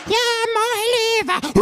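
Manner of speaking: high, slow drawn-out mock singing; mock singer. Comedian parodying a pop singer, high and slow. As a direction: stretch it out like a ballad.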